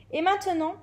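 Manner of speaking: lightly voiced teacher taking charge; teacher's instruction. A narrator's reading. Flying teacher addressing the class.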